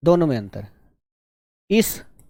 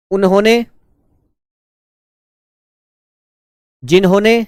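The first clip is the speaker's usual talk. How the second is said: raised, carrying voice, one word at a time; slow dictation; 4 semitones higher, 4 dB louder than usual. Shown as a demonstration. Teacher repeats shorthand words for students to write, slow dictation. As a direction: call out each word with long pauses to write. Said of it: "धीरे धीरे बोल रहा हूँ".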